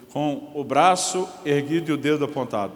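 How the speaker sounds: normal talking voice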